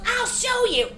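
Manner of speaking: very high, loud, feisty mom; imitated mom. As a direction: announce it defiantly in mom's high voice. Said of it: "She goes".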